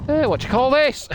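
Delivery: cartoonish high mock question; silly put-on voice. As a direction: squawk it like a caricature. Mock voice mid-story, laughs after.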